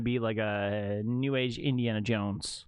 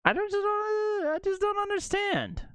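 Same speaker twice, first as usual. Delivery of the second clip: baffled whine. Real, not acted. Baffled by the casting.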